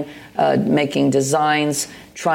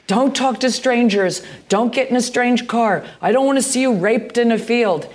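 lightly voiced mother, pitched higher; imitating mother; 8 semitones higher than usual. Mother warning her young daughter, lightly imitated, higher. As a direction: quote her mom's warning a touch higher. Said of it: "My mother would always be like".

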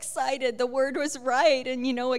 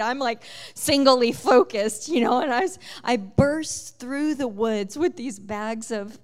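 breathless excitement; 2 semitones higher, 7 dB louder than usual. Retold or imitated. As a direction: tell it like you're running into the woods, heart pounding. Rushing into the woods.

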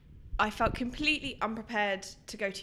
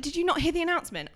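mock shout; 8 semitones higher, faster than usual. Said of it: "shouting at this woman".

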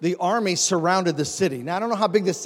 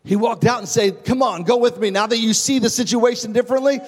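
louder, higher sermon delivery with sung peaks; chanting preacher drive; full-force preaching; 4 semitones higher, 4 dB louder than usual. Acted. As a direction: whoop it up for the congregation.

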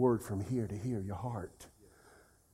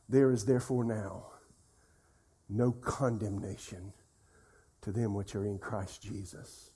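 lower, measured verse recital with long pauses; meditative recitation; 6 semitones lower, 6 dB quieter than usual. Shown as a demonstration. Preacher showing how he meditates on a verse, low meditative recitation. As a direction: let each phrase of scripture settle, softly. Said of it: "I've meditated on that verse".

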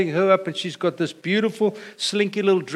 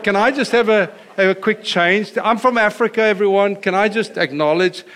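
higher and louder, pleading to be counted in; eager aside; 5 semitones higher, 6 dB louder than usual. Retold or imitated. Pastor voicing his own wish at the wedding, eager and loud. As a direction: beg the room to let you claim Africa. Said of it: "I thought".

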